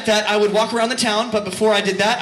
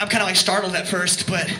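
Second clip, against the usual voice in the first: usual speaking voice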